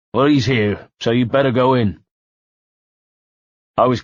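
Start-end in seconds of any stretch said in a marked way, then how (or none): 0.1-2.0 a thug teasing in broad West Country; West Country accent. Acted. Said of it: "in a heavy West Country accent".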